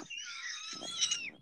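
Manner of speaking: very high squeaky giggle; breathless high giggle. Real, not acted. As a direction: squeal with laughter. Giggling at partner's reaction.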